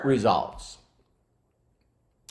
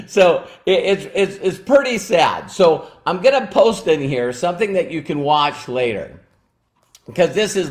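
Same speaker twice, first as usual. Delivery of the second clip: ordinary delivery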